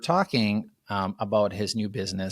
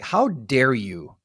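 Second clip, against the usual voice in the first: mock indignation; 2 semitones higher, 3 dB louder, much slower than usual. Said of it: "I was like".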